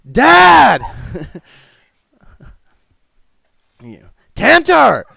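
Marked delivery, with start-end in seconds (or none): 0.1-0.8 high, very loud shout; calling for Dad. Acted. Grown son summoning his father, loud shout. 4.3-5.0 high, loud holler; calling out. Acted. Son calling toward the back, loud holler. Amos calls out.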